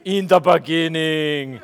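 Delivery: slow, lightly voiced action-hero intonation with a laugh; drawn out as if Arnold opens Genesis; a hint of a giggly Terminator; Arnold Schwarzenegger impression. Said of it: "I was thinking".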